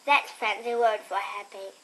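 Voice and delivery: usual speaking voice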